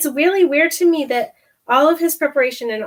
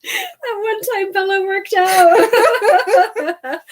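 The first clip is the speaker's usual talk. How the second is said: high, drawn-out words dissolving into laughter; real laughing tease; 7 semitones higher, 4 dB louder, much slower than usual. Real, not acted. Teasing Bella about working out, laughing hard. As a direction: laugh your way slowly through it.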